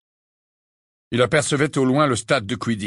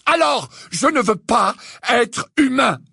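slow, high, loud furious shout; roaring; 13 semitones higher, 5 dB louder than usual. A narrator's reading. Harry refusing to be human, roaring. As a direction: roar each word out in anguish. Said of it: "rugit-il".

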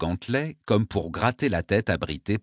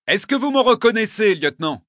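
stern demand; 14 semitones higher, 7 dB louder than usual. Acted. Wounded governor demands recognition.

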